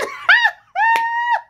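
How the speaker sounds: loud, high burst of real laughter; helpless shrieking laugh. Real, not acted. Wife cracking up on camera, loud laughter. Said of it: "Why am I laughing like this on camera?".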